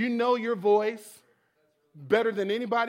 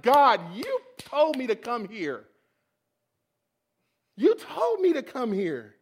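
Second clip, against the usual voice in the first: drawn-out, louder protest at God; slow, stressed accusation thrown upward; upset complaint to God; 3 semitones higher, 5 dB louder than usual. Retold or imitated. Driving home, protesting to God, upset. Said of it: "I'm just upset".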